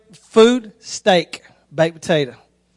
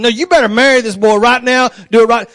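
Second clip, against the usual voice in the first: raised-voice mock threat; loud, higher acted command; shotgun-wedding order; 4 semitones higher, 6 dB louder than usual. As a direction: order the wedding like an armed parent. Acts someone forcing a shotgun wedding.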